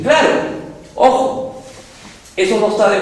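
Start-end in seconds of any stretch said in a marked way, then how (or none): none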